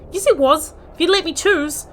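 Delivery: loud, high frustrated retort; real annoyed outburst; indignant protest. Real, not acted. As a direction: argue back at the game. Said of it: "I thought I was gonna get a choice".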